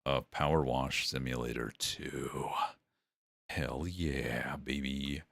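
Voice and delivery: breathy excited whisper; hyped whisper. Real, not acted. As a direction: whisper it with glee. Hyped whisper about sequel.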